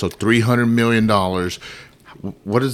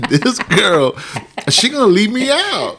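mock worried; 12 semitones higher, slower than usual. Husband mimics his fear wife leaves him out. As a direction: fret it up high, suspicious.

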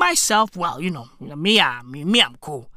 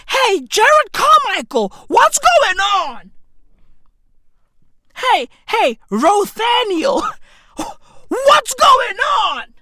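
mock greeting; 5 semitones higher, slower than usual. Calling out a greeting, slowed.

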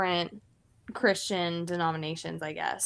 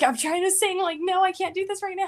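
high, strained, fighting back tears; pitched way up, on the verge of crying; choked-up pleading; 11 semitones higher, 3 dB louder than usual. Retold or imitated. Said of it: "I'm like".

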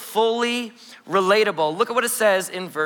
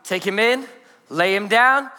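ordinary talk, nothing marked

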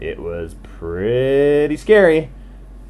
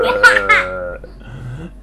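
laugh; 3 semitones lower, 3 dB louder than usual. Acted. Laugh after frog bonks.

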